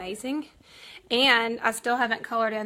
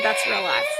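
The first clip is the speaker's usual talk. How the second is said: excited outburst; 4 dB louder than usual. Real, not acted. Laughing off a painful moment.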